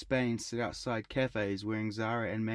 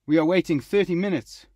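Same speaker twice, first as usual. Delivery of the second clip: loud, high exasperated outburst; shouting at the server; angry complaint; 7 semitones higher, 12 dB louder than usual. A narrator's reading. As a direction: lose patience with the waiter.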